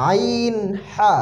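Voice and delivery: slow আইন, হা with pressure in the throat; pressed throat sounds. Shown as a demonstration. Teacher showing the pressure, slow throat-pressed আইন, হা. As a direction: squeeze আইন and হা out from inside the throat. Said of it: "একটু চাপ দিয়ে উচ্চারণ হবে".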